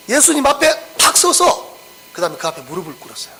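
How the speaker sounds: ordinary talk, nothing marked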